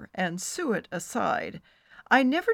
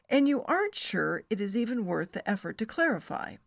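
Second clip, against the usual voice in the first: plain reading voice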